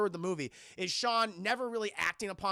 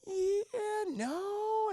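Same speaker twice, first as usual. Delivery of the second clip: much higher sheepish uh, yeah, no; higher flustered boyfriend caught out; awkward hedging; 13 semitones higher, much slower than usual. Retold or imitated. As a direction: squirm out of telling her. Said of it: "Sean's like".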